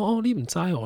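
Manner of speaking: teasing high auntie voice; shopkeeper auntie. Retold or imitated. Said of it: "他说".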